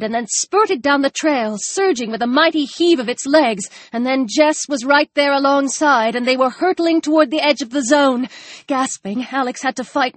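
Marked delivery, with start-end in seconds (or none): none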